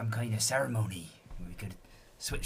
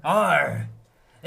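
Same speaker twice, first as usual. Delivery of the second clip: pirate growl; 10 dB louder than usual. Acted. Pirate signal call.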